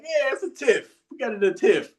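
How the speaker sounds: high sarcastic "Yeah, it's a tiff" from a fuming husband; sarcastic agreement. Retold or imitated. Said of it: "I'm over here pissed though".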